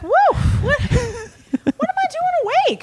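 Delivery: high, confused waking-up voice; comically alarmed, high-pitched puzzlement; mock bewilderment. Acting waking up sick, confused.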